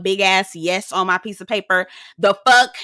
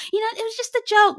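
high fast mock Lisa; 13 semitones higher, faster than usual.

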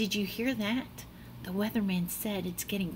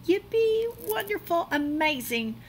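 high, excited cheer; 7 semitones higher than usual. Acted. Child cheering at the first snowfall. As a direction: burst out with delight.

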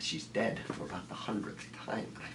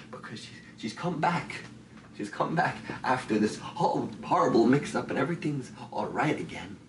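loud, building higher into frantic hope; desperate outburst; 3 semitones higher, 8 dB louder than usual. Acted. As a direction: pour it out, rising in pitch and force. Desperate hope she has returned.

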